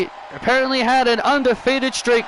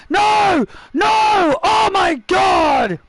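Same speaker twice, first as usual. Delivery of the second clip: horrified shout; 8 semitones higher, much slower than usual. Real, not acted. Commentator watching his colleague grabbed, horrified shout. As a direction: yell in shock at the attack.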